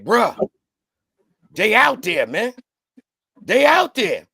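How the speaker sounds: hyped warning, rising at the end; urgent news. Retold or imitated. Calling a friend at dawn, urgent hyped warning. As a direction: push it out like breaking news on the phone.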